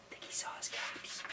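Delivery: unvoiced whisper; whispered aside. Reader answers his own question, whispering.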